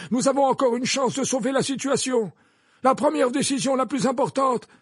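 high, loud, insistent defence; forceful headmaster. Acted.

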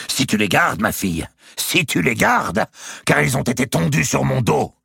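high, loud indignant retort; angry threat. A narrator's reading. Countess threatens to withdraw gifts.

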